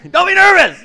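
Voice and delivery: high, very loud mock yell; comic full-volume shout. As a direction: bellow it at a nervous person. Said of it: "you yell, "Don't be nervous".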